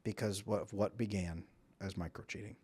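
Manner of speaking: soft, low, quietly grieving; mild heartbroken testimony. Retold or imitated. Hurt mothers telling of broken families, soft and grieving. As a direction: speak the hurt gently and low. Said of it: "women who come in and say".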